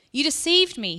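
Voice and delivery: loud, high accusing shout. Acted. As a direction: yell it out in betrayed fury. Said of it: "he shouted".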